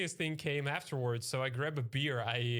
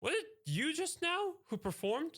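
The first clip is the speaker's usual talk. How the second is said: lightly voiced girl, pitched much higher; the blonde girl asking; 13 semitones higher than usual. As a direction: mimic her eager question in a high voice. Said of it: "She goes".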